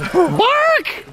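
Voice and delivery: sudden very high-pitched cry; high yelp. Pretend. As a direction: yelp it like a squeal.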